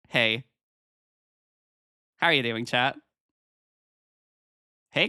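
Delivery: ordinary delivery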